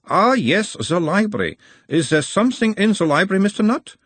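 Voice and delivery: put-on high, probing analyst in Uberwald accent; Uberwald-accented therapist, pitched well up; mild Uberwald accent. A narrator's reading. Nutt as analyst asks about his mother, Uberwald accent. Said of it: "asked in a mild Uberwaldian accent".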